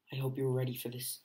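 ordinary delivery

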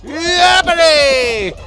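long, high, loud cheer; real excited whoop; excited shout. Real, not acted. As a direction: stretch it out in a triumphant holler. Cheering a good hit.

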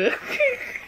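high laugh breaking toward a cry; squealing laugh of disgust. Real, not acted. Reacting after tasting eggnog.

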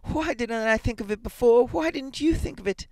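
put-on high-pitched frantic old woman; feverish, high excitement; hysterical. A narrator's reading. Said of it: "she said hysterically".

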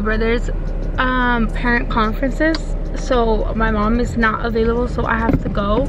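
slight lisp from braces; talking around fresh brackets; speaking with new braces. Real, not acted. Seen 1: Braces seen on teeth when mouth opens, e.g. +2.5, +3.1.

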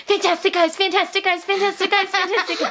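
high, sing-song mock cheer, repeated; imitating her excited son. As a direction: chirp it over and over like an eager kid. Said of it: "he'd be like".

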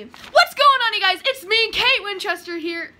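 high, loud cheery greeting; cheery vlog intro. Acted. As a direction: bounce into the intro. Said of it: "a video that he pulled up".

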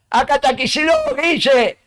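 high-pitched mock yell from across the street; retold shout. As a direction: imitate someone yelling to get his attention. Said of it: "me gritan desde la izquierda".

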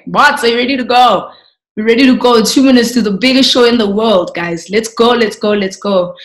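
rally the crew for the broadcast, loud; a lively, loud pep call to go live; rallying director. Retold or imitated.

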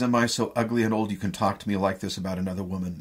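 fast, rushed wounded question; a lover wounded by talk of another woman, quickly; hurried hurt outburst. Acted. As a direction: rush it out in hurt disbelief.

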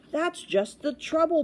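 high, slow royal bellow. A narrator's reading. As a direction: bellow it as an exasperated storybook king. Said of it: "bellowed the king".

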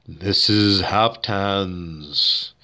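very slow low mumbled devil line; devil undertalk. Shown as a demonstration. Lightly voiced devil muttering under the preacher. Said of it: "I would say".

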